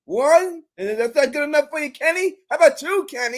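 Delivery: high, loud mocking taunt; Sarah taunting Kenny over bullets, high and loud. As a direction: needle him with each bullet count. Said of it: "she's like".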